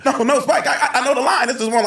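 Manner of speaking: high, loud mock-panicked pleading; flustered protest. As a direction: plead your case, hammed-up and laughing. Retold flustered plea to director.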